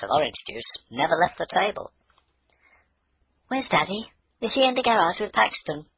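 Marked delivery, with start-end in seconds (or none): 3.5-5.8 put-on high, eager child's voice; a girl rushing out to see her deer; eager little girl.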